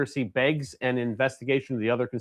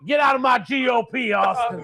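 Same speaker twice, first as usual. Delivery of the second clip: put-on higher, yelled old man voice; angry boomer shout; 9 semitones higher than usual. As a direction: bark it like a ranting boomer. Boomer yelling.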